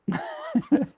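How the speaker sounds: brief high chuckle; amused little laugh before carrying on; short laugh. Real, not acted. Teacher recalling a class member's joke, short high laugh.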